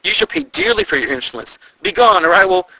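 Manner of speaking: high, loud raging threat; furious shout. A narrator's reading. The queen threatening the ghost, shouting in rage. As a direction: hurl it at the specter. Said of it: "she roared".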